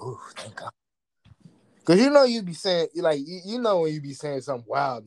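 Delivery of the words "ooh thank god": breathy whispered thank God; whispered relief. Retold or imitated. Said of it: "I be like".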